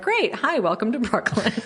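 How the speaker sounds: bright, high mock welcome with a laugh in it; mock cheery welcome. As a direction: chirp it like an overly friendly host. Mocking the friendly Brooklyn group.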